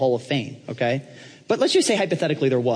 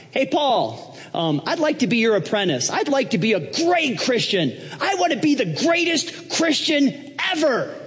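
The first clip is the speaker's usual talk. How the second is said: high, eager, pushy voice, slowing at the end; a preacher playing Trump begging Paul to be his apprentice, high and eager; eager Donald Trump; 11 semitones higher, slower than usual. Trump asking Paul for apprenticeship, high pitched and keen. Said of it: "And he walked in one day and said".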